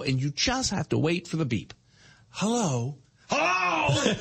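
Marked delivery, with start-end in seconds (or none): 2.3-3.0 even, polite automated hello; lightly imitated machine greeting; car voice assistant. Car's voice assistant greets dad, lightly imitated. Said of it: "she says hello". 3.3-4.1 high-pitched hollered hello; impatient dad yelling. Retold or imitated. Dad answers the car assistant too early, mock shout. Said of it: "he's yelling before the beep".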